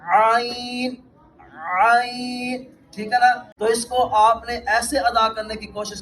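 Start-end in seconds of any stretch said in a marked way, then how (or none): 0.1-2.6 آئین with the voice briefly held back in the throat; held throat ayn. Shown as a demonstration. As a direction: stop the voice a moment, then آئین from mid-throat. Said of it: "آواز کو تھوڑا ٹھہراؤ کرنا".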